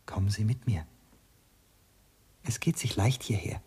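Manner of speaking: hushed guiding whisper. Acted. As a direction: lead him on in a whisper. Servant leads into darkness, whispering.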